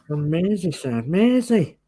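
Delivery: mock Scouse, climbing high on the last word; awful Scouser accent. Host plays on the place name in a bad Scouse accent. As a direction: do a badly faked Liverpool accent. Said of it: "that's my awful, um, Scouser accent".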